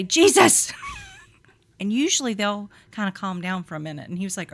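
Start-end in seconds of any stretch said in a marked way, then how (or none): 0.0-0.8 sudden loud call of the name; calling Jesus. Retold or imitated. Mom telling kids to calm down, loud call. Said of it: "just say".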